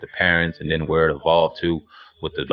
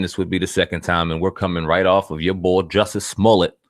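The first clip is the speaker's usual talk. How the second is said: normal talking voice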